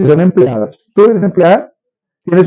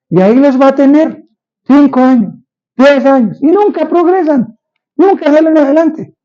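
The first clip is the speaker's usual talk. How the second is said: much higher, impassioned pastor's lament; fervent preaching; 10 semitones higher than usual. Real, not acted. Pastor warns the church about stalled lives, fervently. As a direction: lift it high, pleading with the flock.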